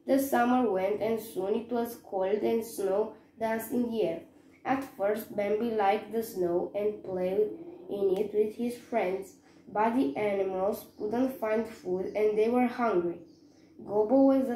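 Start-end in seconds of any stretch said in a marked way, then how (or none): none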